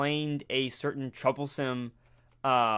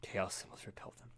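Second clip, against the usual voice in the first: whispering; 5 semitones lower, 14 dB quieter, faster than usual. Acted. Quoting game mechanic in whisper.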